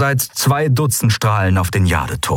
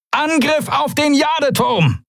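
very high, drawn-out attack order; battle cry. A narrator's reading. Quailor ordering attack on the tower, long high shout. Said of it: "rief Quailor".

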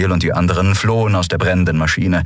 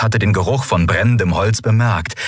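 ordinary delivery

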